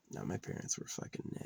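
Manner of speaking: quiet bitter whisper aside. Real, not acted. Son complaining about his parents, real whisper. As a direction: say it under your breath.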